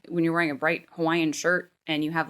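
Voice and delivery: normal talking voice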